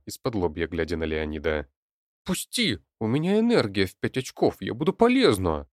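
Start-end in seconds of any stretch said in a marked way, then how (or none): 2.2-5.7 put-on high, insistent girl's voice; urgent protest. A narrator's reading. Daughter begs to join her father, high and urgent. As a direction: plead forcefully, pitched well up. Girl pleads to be released.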